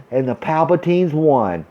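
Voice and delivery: usual speaking voice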